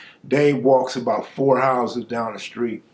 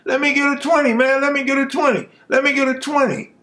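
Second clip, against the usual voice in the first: pleading yell; 11 semitones higher, faster than usual. Retold or imitated. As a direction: whine up high for a twenty. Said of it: "yelling out my middle name".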